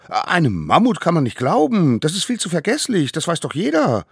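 loud, high, indignant protest; objecting child. Acted. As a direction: protest at once in a squeaky little voice. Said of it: "erhob sofort Einspruch". (lauter, hoher Kinderprotest)

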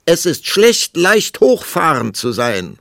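high, loud, stretched call; calling after. A narrator's reading. Queen getting the last word on departing king, calling out. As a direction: shout it after someone leaving. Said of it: "rief die Königin ihm nach".

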